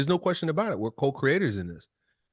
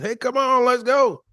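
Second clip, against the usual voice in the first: much higher, loud call over the rotors; helicopter call; 13 semitones higher, 8 dB louder than usual. Retold or imitated. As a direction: yell down from the helicopter.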